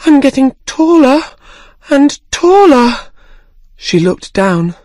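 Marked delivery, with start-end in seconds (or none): 0.0-2.9 high, loud and urgent; alarmed. Acted. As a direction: panic as she keeps growing. Alice growing, alarmed.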